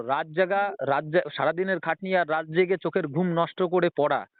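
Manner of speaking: ordinary delivery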